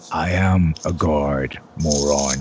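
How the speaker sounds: low, slow and deliberate; authoritative guard. Acted. Guard showing his medal to the townsfolk, low slow put-down. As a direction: drop the voice and spell it out.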